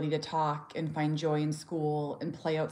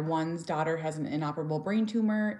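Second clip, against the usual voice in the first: ordinary talk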